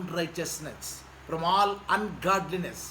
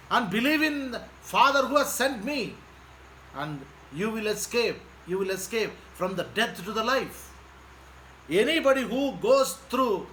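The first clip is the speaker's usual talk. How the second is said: forceful preaching; 3 semitones higher than usual. Acted. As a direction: preach it out to the crowd. Preaching to congregation.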